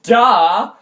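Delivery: loud, high sarcastic retort; mock exasperation. Boy retorting to his arguing brother, loud exasperation. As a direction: throw it out loud, as if painfully obvious.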